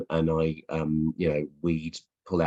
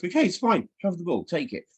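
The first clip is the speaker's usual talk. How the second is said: giving up the ball; 10 semitones higher than usual. Retold or imitated. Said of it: "anybody that's got the ball just goes".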